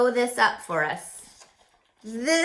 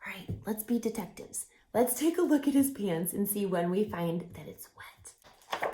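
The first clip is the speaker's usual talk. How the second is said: hushed whisper, fast at first; conspiratorial whisper; 2 semitones lower, 8 dB quieter than usual. Real, not acted. Host inviting children to play detectives, whispered. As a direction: whisper like a sneaky detective sharing a clue.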